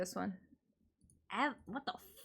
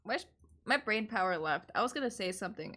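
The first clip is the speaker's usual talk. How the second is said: ordinary talk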